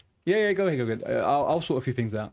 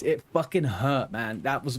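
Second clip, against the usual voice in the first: usual speaking voice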